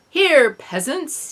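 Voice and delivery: slow, loud, high mock-posh British accent; fake British accent. A narrator's reading. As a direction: lord it over the peasants in fake British. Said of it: "he said in a fake British accent".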